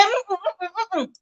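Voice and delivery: string of quick closed-mouth no's; hummed refusal. Retold or imitated. Online in-laws turning down the apology, hummed refusal.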